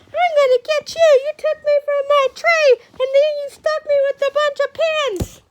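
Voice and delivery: very high, loud pretend rant; mock furious bull. Teacher voices Ferdinand threatening the crowd, hammed-up fury. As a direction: shriek it like a bull out for revenge.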